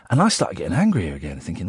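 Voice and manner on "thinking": ordinary delivery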